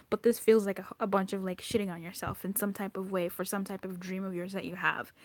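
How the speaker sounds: normal talking voice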